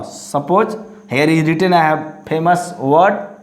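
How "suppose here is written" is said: ordinary talk, nothing marked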